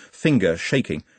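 usual speaking voice

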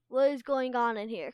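loud booming demand. A narrator's reading. Castell catching the dragonets slacking, booming loud. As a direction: boom it at the whole cave. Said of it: "Castell's booming voice".

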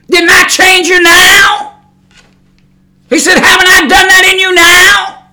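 high, booming rhetorical questions; the Lord's rebuke. Retold or imitated. The Lord answering the preacher, booming rebuke. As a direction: demand it as God.

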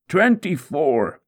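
drawn-out count of a lash; pained count. Acted. Counting lashes during the scourging.

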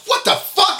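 high-pitched incredulous outburst; comic retelling of startled alarm; retold shocked yelp. Retelling shock at door being yanked.

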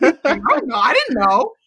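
high, giggly admission; laughing. Retold or imitated. Imitating the husband's admission, laughing. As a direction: toss it off with a laugh. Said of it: "husband's confession retold".

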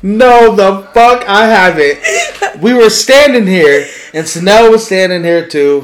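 high, loud laughing objection; loud protest. Real, not acted. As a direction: cut in to deny it. Loudly disputing best friend claim.

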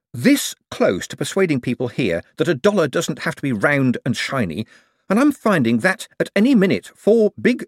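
high-pitched exasperated rant; worked-up, indignant tirade; hysterical. A narrator's reading. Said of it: "no need to get hysterical".

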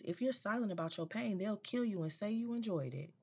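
quoting a quote. Retold or imitated. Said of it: "one of her quotes is".

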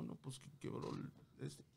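slow, unvoiced whispered confession; scared whisper. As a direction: whisper it like a frightened boy caught out. Quoting the scared son.

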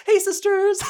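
very high, loud sing-song YouTuber greeting; James Charles impression. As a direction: squeal it out like a beauty vlogger greeting fans. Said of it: "Oh my God, James Charles".

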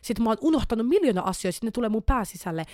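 usual speaking voice

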